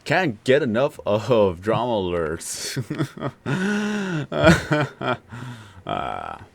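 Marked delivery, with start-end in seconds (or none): 2.8-5.8 high laugh. Real, not acted. Laughing at drama alerts.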